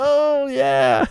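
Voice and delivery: very high, drawn-out squeak of embarrassment; awkward realisation. Retold or imitated. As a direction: shoot the pitch up as it dawns on you. Retold awkward realisation with fans.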